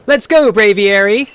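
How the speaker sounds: cocky rival calling out, high; high playful rival voice; character voice. Acted. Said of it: "That stanza's so funny".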